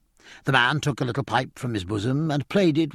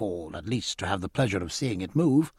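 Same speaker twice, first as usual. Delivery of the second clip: ordinary delivery, nothing marked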